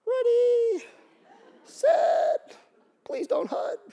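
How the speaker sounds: very high, squeaky frightened snap call; terrified rookie quarterback. Acted. Rookie calls the snap facing huge linemen, high frightened squeak. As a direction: squeak out the count, scared stiff. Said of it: "My knees were literally knocking".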